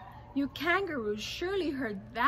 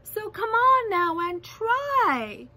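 high, loud impassioned encouragement; rally them with urgent conviction; calling out; 7 semitones higher, 6 dB louder than usual. A narrator's reading. Captured elephant calling encouragement to the mayor, calling out. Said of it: "managed to call to the mayor".

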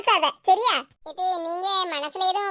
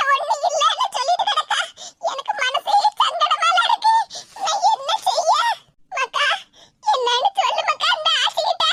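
fretting aachi, voice pitched up and pleading; worried grandmother; 11 semitones higher than usual. Acted. Grandmother fussing over the sobbing girl, high anxious call.

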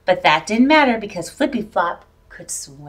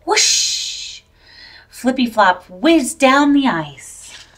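usual speaking voice

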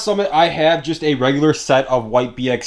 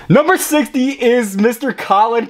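slow, high winner reveal; hyped giveaway announcement; 7 semitones higher, 3 dB louder, slower than usual. Real, not acted.

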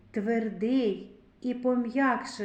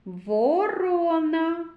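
slow drawn-out word; 6 semitones higher, 4 dB louder, much slower than usual. Shown as a demonstration. Teacher to children in sound game, slow drawn-out word. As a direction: stretch Ворона slowly and loudly, sounding each part.

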